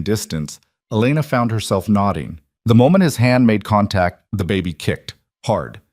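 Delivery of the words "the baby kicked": ordinary delivery, nothing marked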